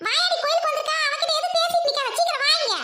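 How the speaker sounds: high, sharp mother call. Acted. Mother calls Siva away from the girl, high and urgent. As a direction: summon your son across the temple.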